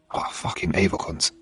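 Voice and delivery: hushed disgusted whisper; whispered aside. Real, not acted. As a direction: breathe it out under your breath. Disgusted aside about killers.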